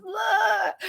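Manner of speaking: high, sudden mock scream from the video; a quick high yelp of terror, retold; imitated scream. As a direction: shriek it like a horror movie. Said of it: "And I hear this".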